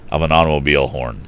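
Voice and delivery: ordinary delivery, nothing marked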